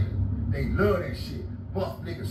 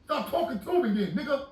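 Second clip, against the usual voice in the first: shouting back at the cellmate, higher; retold shout; 10 semitones higher than usual. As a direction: bark it back at him. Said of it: "I said".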